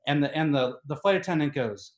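usual speaking voice

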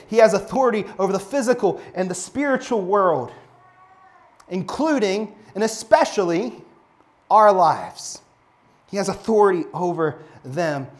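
fervent pulpit proclamation; preaching at full force. Acted. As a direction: build it up like a preacher in full flight.

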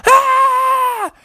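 very high, long scream; hammed-up shriek of panic; panicked scream. A narrator's reading. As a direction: scream like terrified townsfolk. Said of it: "they started to scream".

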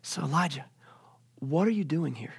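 hushed, whispery question; God's gentle whisper; soft divine voice. Acted. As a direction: ask it gently, barely voiced. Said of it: "a voice came to him and said".

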